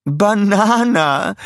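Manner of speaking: high, loud, slow delighted echo; enthusiastic repeat. Acted. Scarlett echoes Stuart's word, high and delighted. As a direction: repeat it with gusto. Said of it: "wiederholte Scarlett begeistert".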